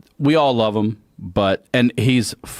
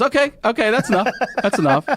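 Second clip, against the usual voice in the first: judge cutting in; 13 semitones higher, faster than usual. Retold or imitated. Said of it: "She goes".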